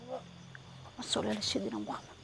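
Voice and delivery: voiceless, hushed prayer; whispered prayer. Real, not acted. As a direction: breathe the prayer out barely audible.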